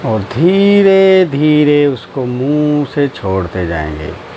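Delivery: low, slow drawn-out meditation voice; guided meditation. Acted. Teacher guiding friends through breathing and relaxing, guided meditation.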